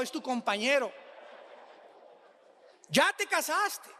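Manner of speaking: ordinary talk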